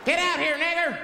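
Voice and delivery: high, loud mock threat; acted mob shout. Mob member outside the house to the caller, high hostile shout.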